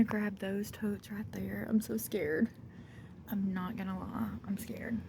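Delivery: nervous, hushed half-whisper. Real, not acted. Scared woman in a snake-filled basement, whispering. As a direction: keep it hushed so nothing stirs. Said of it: "I'm whispering, I'm being quiet".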